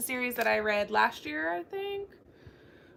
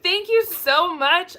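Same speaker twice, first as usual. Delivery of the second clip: touched thanks; 7 semitones higher, 8 dB louder, slower than usual. Retold or imitated. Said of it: "I almost started crying right there".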